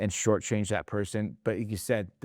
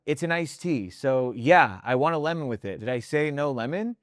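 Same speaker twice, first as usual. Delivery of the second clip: higher-pitched, indignant customer imitation; harsh retort; 5 semitones higher than usual. Customer snapping back at a server over lemon, harsh and high-pitched. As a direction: act out a snippy customer pushing back at the question. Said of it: "that was a little harsh".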